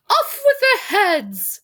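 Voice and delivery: high, slow bossy queen voice; funny queen voice. Pretend. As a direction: play a shrill-tempered chess queen, hammed-up. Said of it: "make up funny voices".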